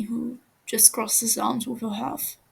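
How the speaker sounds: ordinary delivery, nothing marked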